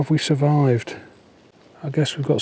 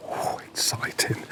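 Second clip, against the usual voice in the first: whispering; 8 dB quieter than usual. Real, not acted. Whispered delight at fog.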